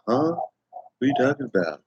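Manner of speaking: a hint of a confused child; puzzled kid. Kid puzzled by grandpa's joke, lightly voiced. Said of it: "most kids will be like".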